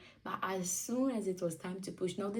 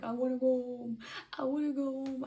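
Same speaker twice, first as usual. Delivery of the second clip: high pleading; 6 semitones higher, 4 dB louder than usual. Retold or imitated. Labouring mother watching people outside, high mock pleading. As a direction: plead to escape, pitched up.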